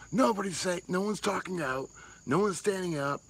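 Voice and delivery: high-pitched mock protest; high, hammed-up griping; mock complaint. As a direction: imitate people complaining, pitched well up. Acts out complaint from his meme.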